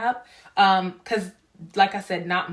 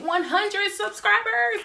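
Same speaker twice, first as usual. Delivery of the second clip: excited; 14 semitones higher, slower than usual. Real, not acted. Excited about milestone.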